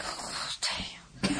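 breathy whispered dismay; whispered oh damn. Retold or imitated. On a plane, realising she was rude, whispering. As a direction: whisper it, caught out. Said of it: "I'm looking at him like".

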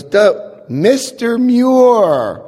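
high, slow, drawn-out delighted greeting; the penny drops, mock welcome; sudden recognition. Sergeant recognising the wanted man, slow mock delight. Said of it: "He goes".

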